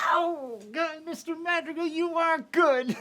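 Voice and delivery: laughing delight. Acted. Father delighted by a trick.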